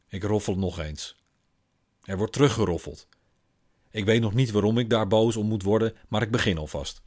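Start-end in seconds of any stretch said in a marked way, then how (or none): none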